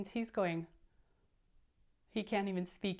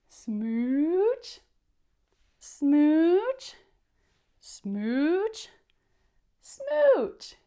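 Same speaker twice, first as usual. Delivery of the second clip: louder, higher smooch on each word; loud smooches; 6 semitones higher, 11 dB louder, much slower than usual. Acted. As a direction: smack out each smooch, building up. Fish kissing everyone, loud smooches.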